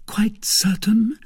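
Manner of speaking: high, slow, doubting question; last doubt. A narrator's reading. Lady checking suitor's pledge, doubtful and high. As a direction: ask slowly for one final assurance. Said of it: "with a last doubt".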